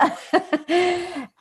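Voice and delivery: a burst of real high laughter; bright delighted laugh. Real, not acted. Laughs at own remark.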